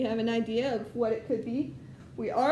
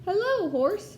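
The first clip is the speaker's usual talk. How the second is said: much higher, chirpy little duck greeting; cheery duck voice; 8 semitones higher, 4 dB louder than usual. Acted. Teacher voicing Duck greeting the farm animals, high cheerful. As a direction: pop up high as a friendly duck.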